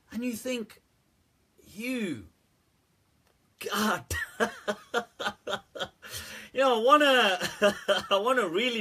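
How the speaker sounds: talking through real laughter; can't hold back the laugh while speaking; words broken up by real laughter. Real, not acted.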